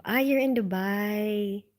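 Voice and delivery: normal talking voice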